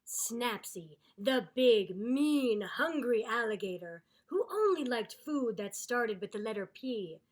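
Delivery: loud, dramatic villain build-up; ominous storybook narration. A narrator's reading. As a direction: narrate like he's a scary predator.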